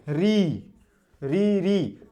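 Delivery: री repeated slowly and clearly; vowel demo. Shown as a demonstration. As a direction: sound out री for the teacher's demo. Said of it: "मूर्धन्य".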